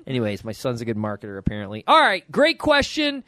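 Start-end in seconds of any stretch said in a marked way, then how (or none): none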